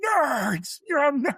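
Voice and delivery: high mocking jeer at nerds; jock bully taunt. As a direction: sneer it like a college bully. Quoting the jock villains.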